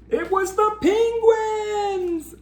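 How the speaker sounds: excited reveal. Acted. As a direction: unveil the surprise with wide-eyed delight. Big reveal in the story.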